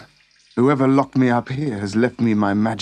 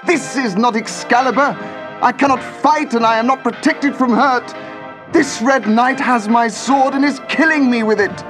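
much higher, louder despairing knight; desperate cry; 12 semitones higher, 4 dB louder than usual. Acted. Arthur losing the duel cries out in despair, high and loud. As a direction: cry out as the fight turns against you. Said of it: "cried Arthur".

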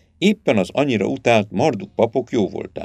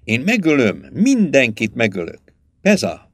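plain reading voice

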